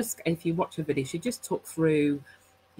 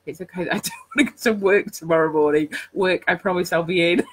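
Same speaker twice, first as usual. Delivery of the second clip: laughing; 2 semitones higher, 8 dB louder than usual. Real, not acted. Laughs while giving example rant.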